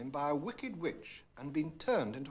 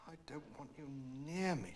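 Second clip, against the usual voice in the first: softer muttered complaint; 9 dB quieter than usual. A narrator's reading. Princess to the frog in her room, softer mutter. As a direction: grumble it under her breath. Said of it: "she muttered".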